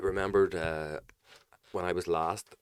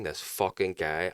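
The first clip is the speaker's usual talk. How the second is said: usual speaking voice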